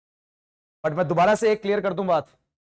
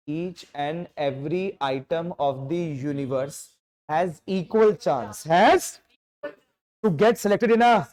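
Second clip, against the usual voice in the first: slow dictation; much slower than usual. Shown as a demonstration. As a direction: stretch each word for note-taking. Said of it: "बात बहुत धीरे से बोल रहा हूं". (बहुत धीरे, ज़ोर देकर लिखवाना)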